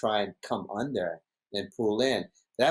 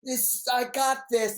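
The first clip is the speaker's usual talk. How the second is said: much higher, slow, mock-cocky imitation; confident Brock; 13 semitones higher, slower than usual. Imitating Brock brushing off his friend, high slow cocky voice. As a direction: drawl it like he owns the wave. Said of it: "He goes".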